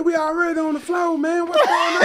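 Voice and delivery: put-on high, loud Florida accent, laughing; mock Florida accent. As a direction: Florida teammate greeting him, high and laughing. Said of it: "With a Florida accent".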